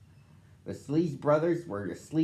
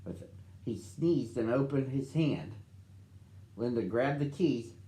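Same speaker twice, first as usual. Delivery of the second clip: ordinary delivery, nothing marked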